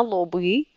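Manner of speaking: "lobri" said softly with a voiced B; "lobri" keeping the B voiced. Shown as a demonstration. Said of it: "le son normal".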